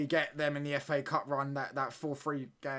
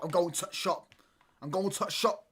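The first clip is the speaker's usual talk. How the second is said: mocking Yorkshire take, "to shop" without "the"; Yorkshire accent; 4 semitones higher, 3 dB louder, slower than usual. Shown as a demonstration. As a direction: exaggerate the Yorkshire "to shop", pitched up. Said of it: "the Yorkshire accent does my nutting".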